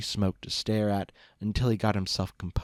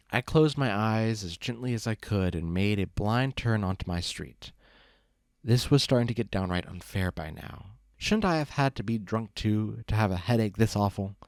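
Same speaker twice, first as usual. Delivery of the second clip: usual speaking voice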